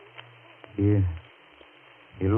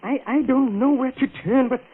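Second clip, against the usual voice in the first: higher and higher, desperate plea; rising panic; 12 semitones higher than usual. Acted. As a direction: voice climbing into panic. Panicked plea after confessing murder.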